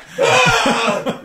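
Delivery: loud, high-pitched cackle; high shrieking laugh. Real, not acted. Laughing at own story.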